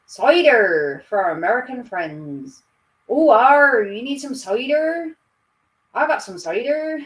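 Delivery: put-on loud, broad rural Norfolk accent. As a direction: lay on a farmer's Norfolk accent. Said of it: "I lose my Norfolk accent on sometimes".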